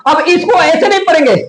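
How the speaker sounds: ordinary delivery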